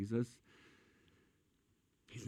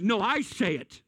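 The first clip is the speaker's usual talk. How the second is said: firm declaration; 8 semitones higher, 3 dB louder than usual. Retold or imitated. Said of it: "He's like".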